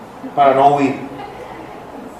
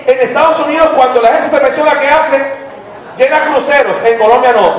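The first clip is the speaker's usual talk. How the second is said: raised, high emphatic preaching; 8 semitones higher than usual. Acted. Pastor contrasting retirees abroad, raised preaching. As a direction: punch it to the pews.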